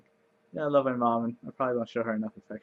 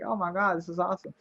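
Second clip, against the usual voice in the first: higher-pitched thrilled gasp; imitating her delight; 7 semitones higher than usual. Player retells discovering bone meal duplicates roses, delighted. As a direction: replay the thrill of finding out. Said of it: "So I was like".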